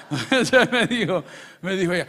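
talking through loud laughter; laughing. Real, not acted. Pastor still laughing at his mother's reply, laughing.

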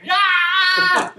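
very high, loud mock shriek; piercing imitation of a woman's scream; imitated scream. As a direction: let out an overwhelmed shriek. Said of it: "And she screamed again".

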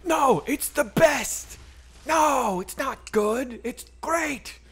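high, slow, squeaky mock protest; mock indignant Todd. Streamer mocking Todd's protest, in a high squeaky whine. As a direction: lampoon a fan defending his movie. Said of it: "I know Todd is like".

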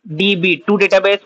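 usual speaking voice

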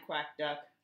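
plain reading voice

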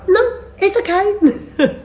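high, slow, sing-song reassurance; mock-soothing nurse sister, drawn out; a stretched airy "no, it's okay" hiding worry; sister's fake calm.

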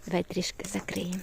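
soft hushed whisper; quiet whispered coaxing. Real, not acted. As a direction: whisper gently so he settles. Hushing the toddler.

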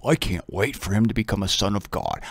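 low, soft dog's inner voice; voicing the dog. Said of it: "The dog says".

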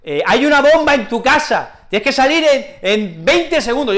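high, loud alarmed phone warning; urgent caller. Pretend. Caller warning of a bomb, loud and urgent. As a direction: warn of a bomb as fast as you can shout. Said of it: "me llaman por teléfono".

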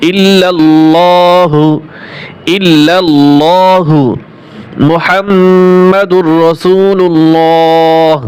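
slow chant-like recital of the kalima; slow recitation. Shown as a demonstration. Teacher reciting the whole kalima for students. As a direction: recite slowly, each word held long.

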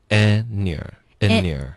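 "and your" run together as en-yer; linked weak forms. Shown as a demonstration. Teacher showing the linking, "and your" as en-yer. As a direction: slowly link "and your", dropping the d. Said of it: "然后再连读".

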